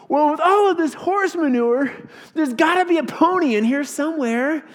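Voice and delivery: put-on bright hopeful kid sure of his luck; cheerful boy. Optimist boy answers his father.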